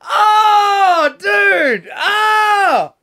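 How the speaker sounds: piercingly high, loud wailing disbelief; horrified shriek. Real, not acted. Listener reacts to chili inside the penis, horrified shrieking. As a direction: wail it in sympathetic horror.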